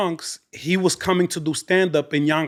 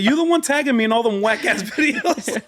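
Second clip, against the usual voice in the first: laughing teasing; 7 semitones higher than usual. Retold or imitated.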